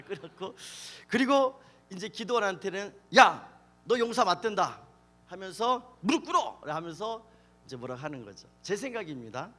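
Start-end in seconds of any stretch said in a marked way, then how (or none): 3.2-4.8 loud acted angel giving orders; brusque announcement. Angel brusquely informs Gideon. 6.0-6.6 high, loud acted order; mock command. Angel orders Gideon to kneel.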